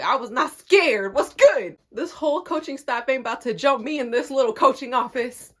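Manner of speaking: defiant boast. Real, not acted. Storyteller insists coaches did not scare her, defiant. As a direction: push volume up, ready to square off.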